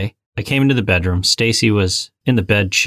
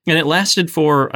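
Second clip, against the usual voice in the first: ordinary talk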